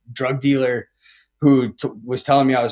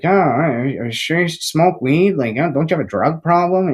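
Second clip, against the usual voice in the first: higher-pitched, lightly mocking nag; naggy mom; 4 semitones higher than usual. Son mocking his mother's questions about weed, lightly naggy. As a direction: mimic a nagging mom, a touch sarcastic. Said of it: "like, naggy".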